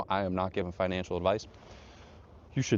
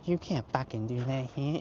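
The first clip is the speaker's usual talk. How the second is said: a hint of Karen, pitched up; mock Karen; 5 semitones higher, 3 dB quieter than usual. Trail Karen objecting to a smoker, mock scolding. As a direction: imitate a scolding Karen in a higher voice. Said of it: "some Karens and moms".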